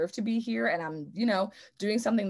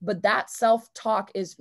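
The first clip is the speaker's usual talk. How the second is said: normal talking voice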